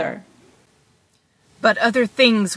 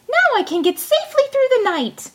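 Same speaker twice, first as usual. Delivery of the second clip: high, small pleased voice; tiny Tom Thumb; 14 semitones higher than usual. A narrator's reading. Tom Thumb cheers himself in the snail shell, high and pleased. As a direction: settle in, tiny and content.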